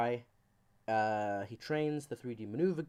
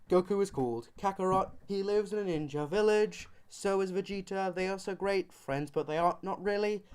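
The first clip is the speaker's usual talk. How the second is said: higher-pitched run-through; mock breakdown recital; 5 semitones higher than usual. Comedian giving mega breakdown of Dragon Ball, higher mock recital. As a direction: rattle off a silly summary in a raised voice. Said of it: "super quick mega breakdown production".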